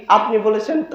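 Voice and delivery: ordinary talk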